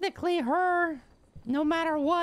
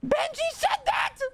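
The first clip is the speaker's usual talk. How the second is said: much higher, amused disbelief; incredulous; 14 semitones higher than usual. Real, not acted. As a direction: ask it as if it can't be true. Disbelief at the news.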